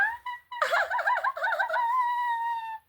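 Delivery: very high, long giggling squeal; high-pitched giddy laugh; delighted squealing laugh. Real, not acted. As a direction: squeal with laughter in pure glee. Overjoyed at the find.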